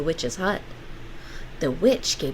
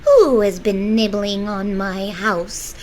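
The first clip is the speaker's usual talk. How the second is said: sharp witch voice; 3 semitones higher, 8 dB louder, slower than usual. Acted. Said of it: "a sharp voice called out".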